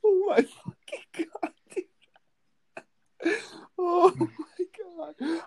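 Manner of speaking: high squealing laugh through words; cracking up, can barely speak; laughing fit. Real, not acted. How the fuck do you say that without laughing.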